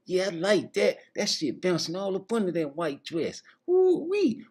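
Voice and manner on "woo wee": high woo-wee; excited holler. Retold or imitated. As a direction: let out a high whoop. Admiring holler.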